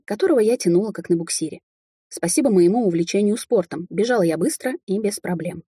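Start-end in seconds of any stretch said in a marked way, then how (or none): none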